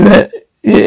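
ordinary talk

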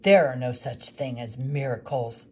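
bitter woodcarver dismissing the widow's hope; gruff woodcarver. Acted. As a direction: shut her down flatly. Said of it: "the woodcarver told her".

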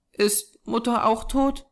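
put-on high, small child's voice; stricken little girl. A narrator's reading. Small girl asking whether her mother died, subdued dismay.

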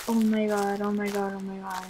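low, fading repeated mutter; uneasy, trailing off to near silence; nervous muttering. Real, not acted.

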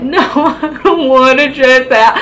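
laughing through the no. Real, not acted. Refusing to dress out in jail, laughing. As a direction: giggle while refusing.

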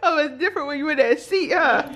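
laughing while teasing. Real, not acted. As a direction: tease her through laughter. Teasing friend in the chair.

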